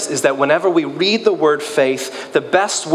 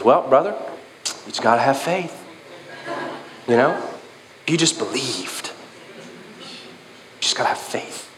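mock pious advice; 6 dB quieter than usual. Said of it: "someone says".

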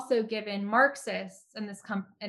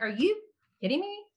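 can't believe what you're hearing, voice shooting higher; incredulous disbelief; 8 semitones higher than usual. Real, not acted. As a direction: pitch jumps way up in disbelief. Scoffing at praise for Cuba.

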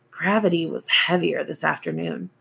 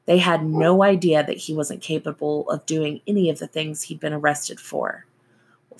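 ordinary delivery, nothing marked

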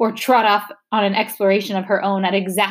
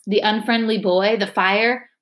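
ordinary delivery, nothing marked

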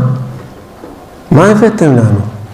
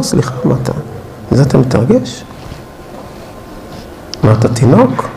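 mock aside; 6 semitones lower, 6 dB quieter than usual. Mocking an adult sports fan.

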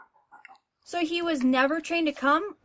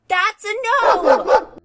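loud, drawn-out, higher reprimand; scolding yell; 4 semitones higher, 8 dB louder, much slower than usual. Acted. Owner catches dog chewing table leg, scolding yell. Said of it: "I didn't mean to yell".

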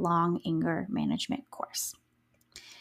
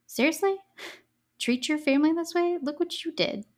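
incredulous; 6 semitones higher than usual. Real, not acted. Mocking Susan's plea.

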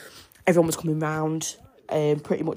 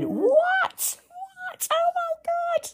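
retold scream; much slower than usual. Mum reliving the gender reveal, shrieking in high disbelief. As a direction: shriek it like the blue just came down. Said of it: "I just remember screaming".

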